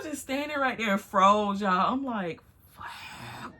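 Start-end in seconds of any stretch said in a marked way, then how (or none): 2.7-3.5 stunned whispered curse; voiceless, frozen whisper; whispered shock. Retold or imitated. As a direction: curse under your breath in disbelief.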